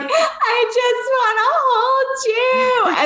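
high, slow, tearful plea that breaks into a wail; imitating her own sobbing as she begs to hold her kids; retold crying plea. Mother missing her kids across the doorway, imitating a slow tearful plea. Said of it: "And I'm hysterically crying".

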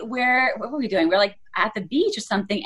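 normal talking voice

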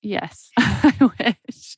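a slow yes broken by a laugh. Real, not acted. Co-host laughs along, wishing someone did bedtime. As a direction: laugh through the wish.

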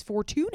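Fortune said as "for-too-NAY"; accented final E. Retold or imitated. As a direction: end Fortune on a stressed "nay". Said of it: "I don't see a accent at the".

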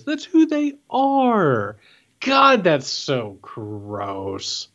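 slow, drawn-out disgust. Real, not acted. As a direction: groan it out in revulsion. Disgusted reaction to senator.

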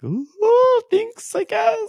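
squeaky high, awkward little thank you; a shy, very high mock squeak of thanks. Host mimics her own squeaky high awkward thanks. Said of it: "I'm like".

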